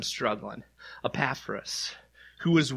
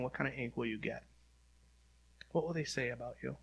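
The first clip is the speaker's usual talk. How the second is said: softer, hushed questions to the listener; soft, quick searching questions; 10 dB quieter, faster than usual. Shown as a demonstration. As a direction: ask it gently, almost under breath. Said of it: "intimate closing questions".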